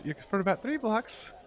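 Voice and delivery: hammed-up high Scottish female voice; Scottish woman. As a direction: switch to a Scottish woman giving directions. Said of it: "I'm going back to Scottish".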